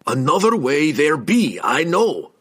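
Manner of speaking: dwarf growling at the stone wall; frustrated growl. A narrator's reading. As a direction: grumble it, fed up with the wall. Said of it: "he growled in frustration".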